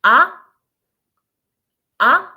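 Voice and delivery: AH rising sharply, like a surprised question; short loud AH sweeping upward; second tone, rising. Shown as a demonstration. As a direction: lift AH from mid to high. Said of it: "rising your tone".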